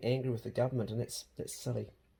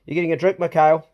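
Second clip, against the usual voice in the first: loud teasing call across the table; calling out loudly; 4 semitones higher, 9 dB louder than usual. Real, not acted.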